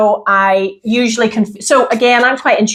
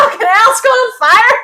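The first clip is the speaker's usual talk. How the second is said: much higher, mock alarm at a fire; panicked cry; 3 dB louder than usual. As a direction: squeal in retold panic, half laughing. Panicked quote, candle on fire.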